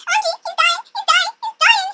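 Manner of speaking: very high mock wail; squeaky put-on sobbing princess; crying. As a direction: wail in hammed-up grief. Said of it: "so she was crying".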